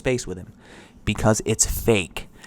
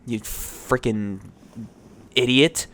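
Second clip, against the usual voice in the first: normal talking voice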